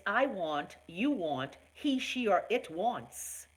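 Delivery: each pronoun set apart with "want" said deliberately; conjugation drill. Shown as a demonstration. Host teaching viewers to conjugate, slow and deliberate. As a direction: spell out "I want," "you want" like a teacher. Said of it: "Learn how to conjugate".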